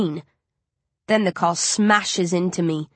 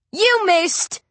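gleeful high hoot, pitched up; mocking hoot at a friend's miss; 12 semitones higher than usual. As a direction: crow over the missed shot. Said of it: "Coley hoots".

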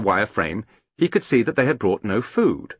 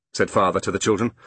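ordinary delivery, nothing marked

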